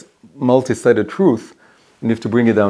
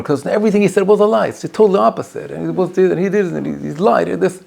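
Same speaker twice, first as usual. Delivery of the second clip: eager counterclaim; 8 semitones higher than usual. Retold or imitated. Second litigant pleads his side.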